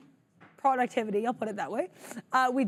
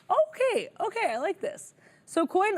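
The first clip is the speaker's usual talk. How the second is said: excited; 10 semitones higher than usual. Real, not acted. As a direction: squeal with delight at the chart. Excited reaction to chart.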